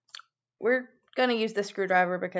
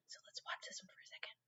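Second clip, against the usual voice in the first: whispering; 26 dB quieter, faster than usual. Real, not acted. Whispering over video.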